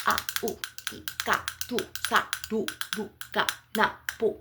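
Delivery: punchy channelled chant breaking into short separate syllables; assertive light language. Acted. Channelling an assertive mother dragon's message to listeners, assertive. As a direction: fire out each syllable with assertive force. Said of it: "she's very assertive with her messages".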